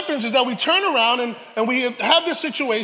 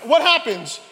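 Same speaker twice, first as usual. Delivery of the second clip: normal talking voice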